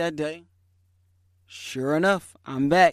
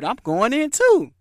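higher and louder, a touch of daring; 8 semitones higher, 9 dB louder than usual. Retold or imitated. Shoppers decide to follow in, said louder and higher. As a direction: blurt it out, half-laughing resolve.

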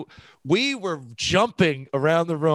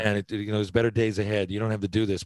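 comforting; 6 semitones lower than usual. Retold or imitated. Consoling a young player after a rough game, gentle and low. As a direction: say it like you're hugging a struggling kid. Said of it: "I'd just wanna hug him and go like".

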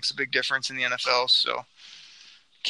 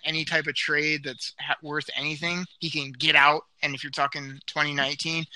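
ordinary delivery

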